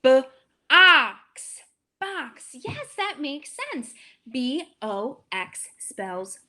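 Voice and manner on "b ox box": "ox" loud, then "box" blended; teacher blends a word; blending sounds. Shown as a demonstration. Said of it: "Let's tap it".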